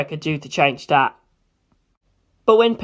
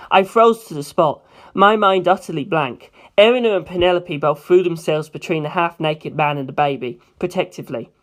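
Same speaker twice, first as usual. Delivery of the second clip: usual speaking voice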